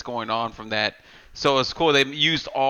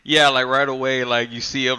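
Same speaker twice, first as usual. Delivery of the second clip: ordinary delivery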